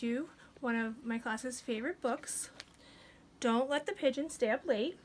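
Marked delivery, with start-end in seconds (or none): none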